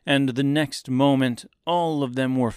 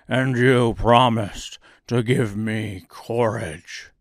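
slow, louder lion voice; 4 dB louder, slower than usual. A narrator's reading. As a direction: rumble it like a timid lion. Cowardly Lion's voice.

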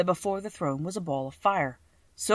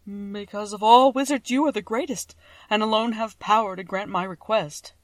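cowardly lion; 4 semitones higher, 6 dB louder than usual. A narrator's reading. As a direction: plead loudly as a cowardly lion. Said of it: "answered the lion".

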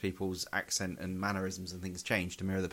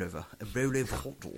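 plummy upper-class English; posh voice; faster than usual. Acted. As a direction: say it like a toff. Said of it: "that posh voice you just did there".

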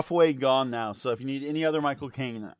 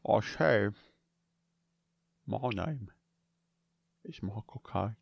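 low, hushed celebrity impression with long pauses; Michael Caine; 5 semitones lower, 7 dB quieter than usual. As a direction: sink deep and soft, pausing between phrases. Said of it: "that was old Michael Caine".

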